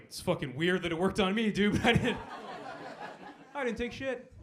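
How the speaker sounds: high, loud protest of innocence; revived addict protesting to paramedics, loud and high; indignant denial. Retold or imitated. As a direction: insist you took nothing, voice climbing. Overdosed patient denies taking drugs.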